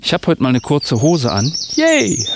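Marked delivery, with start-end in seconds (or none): 1.7-2.2 high, bright cheer; excited yay. Real, not acted. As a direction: cheer it out, delighted. Cheering about his shorts.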